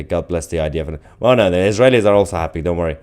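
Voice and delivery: ordinary talk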